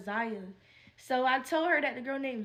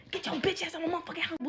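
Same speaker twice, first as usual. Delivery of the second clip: fast, higher, rattled-off throw-out; mock furious eviction; 5 semitones higher, much faster than usual. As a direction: fire it off like kicking her out. Imagining throwing her out now.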